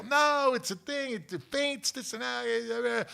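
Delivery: high, frantic mock pleading; panicked reassurance. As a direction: plead over screaming kids, pitched high. Calming screaming kids, acted out.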